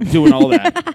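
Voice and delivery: high and loud, fed up; exasperated. Real, not acted. Tech venting to friends about the gate hassle, exasperated. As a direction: throw the words up in exasperation.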